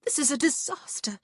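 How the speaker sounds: high, frantic confiding. A narrator's reading. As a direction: say it urgently, pitched well up. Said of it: "she whispered frantically".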